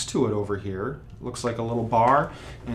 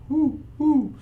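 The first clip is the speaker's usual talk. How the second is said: much higher hooting whoo, whoo; owl hoot; 14 semitones higher than usual. Shown as a demonstration. Teacher imitating an owl to name the bad attack, hooting high. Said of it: "I call that owl tonguing".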